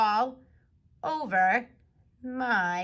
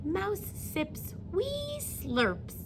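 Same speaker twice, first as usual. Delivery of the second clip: tiny voice; 10 semitones higher, 3 dB quieter, much slower than usual. Acted. Tiny mouse sipping.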